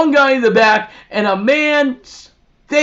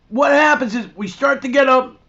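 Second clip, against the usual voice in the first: usual speaking voice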